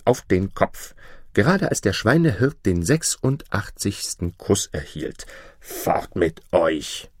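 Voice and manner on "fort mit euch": langsam, drohend verjagend; böse. Acted. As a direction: der zornige Kaiser jagt sie fort, gedehnt. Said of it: "denn er war richtig böse". (Kaiser verstößt Tochter, zornig)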